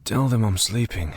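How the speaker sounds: usual speaking voice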